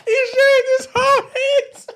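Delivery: high, breaking into laughter mid-sentence; can barely get the words out for laughing; real laughter while telling the punchline. Real, not acted. Teller cracking up at punchline.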